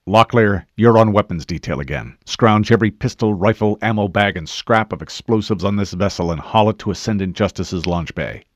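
loud, commanding military orders; rapid-fire orders. A narrator's reading. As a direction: give each team its job at firm volume. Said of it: "The Master Chief gave rapid-fire orders".

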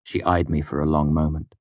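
ordinary delivery, nothing marked

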